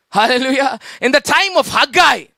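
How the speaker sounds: ordinary delivery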